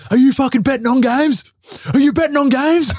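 high, loud mock coach tirade; imitating a coach's yell. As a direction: blow up at the player like an incredulous coach. Coach yelling in locker room.

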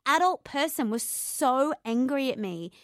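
usual speaking voice

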